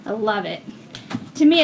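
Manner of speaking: ordinary talk, nothing marked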